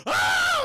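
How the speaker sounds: very high, loud shriek; mock startled scream. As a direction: scream as if waking to a horror. Imitates bride screaming on waking.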